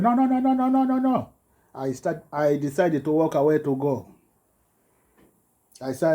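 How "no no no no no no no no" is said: high shouted no's in a rush; retold screaming. Teacher screaming at the parent, high and loud. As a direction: mimic her shrieking refusal. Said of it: "She start screaming".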